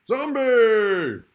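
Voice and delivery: a big theatrical cry of "Zombie!" falling at the end; shouted zombie. Acted. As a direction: belt out "Zombie!" high and long. Zombie word play climax.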